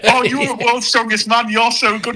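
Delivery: high-pitched starstruck fan voice; gushing fan. Acted. Admirer meeting a strongman, in a high gushing voice.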